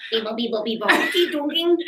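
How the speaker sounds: playful robot beeps; silly clown. Shown as a demonstration. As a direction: beep boop like a toy. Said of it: "It goes like this".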